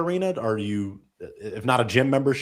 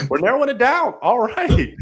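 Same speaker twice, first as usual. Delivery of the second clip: excited hype; 12 semitones higher, 7 dB louder than usual. Real, not acted. Host hyping the contest down.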